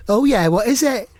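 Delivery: high comic impression. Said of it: "I'll just say them as [name]".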